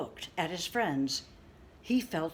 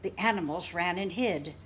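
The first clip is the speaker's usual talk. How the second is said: usual speaking voice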